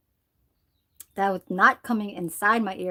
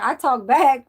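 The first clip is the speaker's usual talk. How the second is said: mock retort; 4 semitones higher, 8 dB louder, slower than usual. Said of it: "he said something like".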